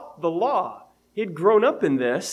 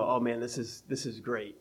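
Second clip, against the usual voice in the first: mock delight; 6 semitones lower, 10 dB quieter than usual. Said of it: "you go".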